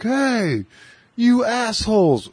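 high, slow put-on drunk yell; mock the short drunk cursing them; drunk guy yelling. Short drunk guy yelling up at the kids, high mock yell. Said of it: "he's like".

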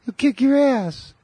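put-on very high, squeaky boy's voice; Randy's high voice. Randy bragging about his dad, squeaky high boy voice. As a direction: brag like a kid whose voice hasn't dropped. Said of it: "He had a high voice".

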